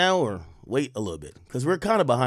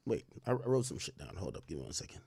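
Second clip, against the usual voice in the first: normal talking voice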